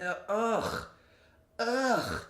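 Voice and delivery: drawn-out "ugh" of revulsion; throaty, fed-up groan; disgusted groan. Real, not acted. Disgust at recalling her behaviour.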